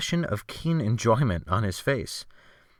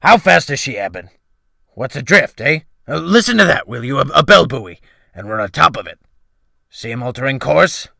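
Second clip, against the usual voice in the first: loud, rough sea-dog calling out; bellowing old sailor; 3 semitones higher, 8 dB louder than usual. A narrator's reading. Excited sailor shouts about the bell buoy, bellowing. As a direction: roar it over the wind and bells. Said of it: "bellowed, rather".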